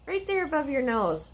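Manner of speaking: soft doting voice to a dog; pet talk. Real, not acted. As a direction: tease the pup gently about its fur. Talking to the dog.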